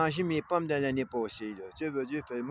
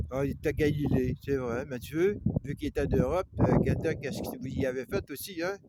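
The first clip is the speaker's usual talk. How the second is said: ordinary delivery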